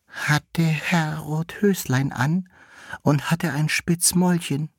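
high lingering question of a widowed vixen. A narrator's reading.

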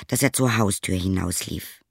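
ordinary delivery, nothing marked

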